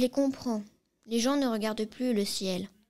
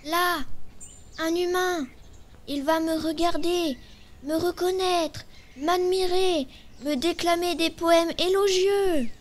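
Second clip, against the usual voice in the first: high, slow, grandly declaimed daydream; hammy stage actor; 7 semitones higher, 5 dB louder than usual. Acted. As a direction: ham it up like a stage diva. Stork dreams of finally being admired.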